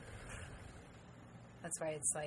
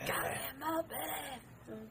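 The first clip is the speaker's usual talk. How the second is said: slow, higher, softer Fat Bastard voice; Fat Bastard impression; 7 semitones higher, 8 dB quieter, much slower than usual. Quoting Austin Powers with friends, as a slow Fat Bastard. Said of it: "I'll throw in fat bastard".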